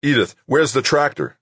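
tense, pressing shout for help; urgent call. A narrator's reading. Trapped rescuer calling to arriving helper.

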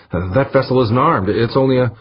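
high, urgent, breaking off unfinished; agitated protest. A narrator's reading. Navigator arguing to captain as the ship lurches, high agitated protest. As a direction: protest high until cut off.

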